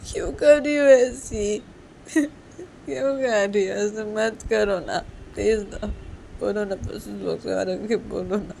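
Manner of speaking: high, slow, drawn-out wail; tearful, stretched-out pleading; crying plea. Acted. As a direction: beg her through acted sobs. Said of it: "फिर से रो रही हो?".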